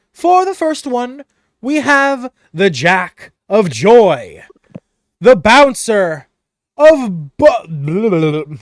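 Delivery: high, grand ringmaster announcement; a booming master of ceremonies, slowing down to make the big reveal; carnival announcer. Acted. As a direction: build it up like a showman calling out winners. DM announces carnival prize winners.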